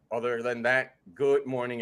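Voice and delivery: normal talking voice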